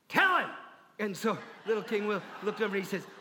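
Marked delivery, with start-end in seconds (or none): 0.1-0.5 high, loud push; urging. Acted. Advisor pressing her colleague, high and loud. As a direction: shove him into speaking. She urges the other advisor.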